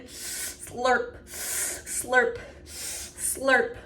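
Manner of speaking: breathy drawn-out slurps; cat slurping. Shown as a demonstration. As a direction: lap it up like a big cat. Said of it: "Like a big cat drinking".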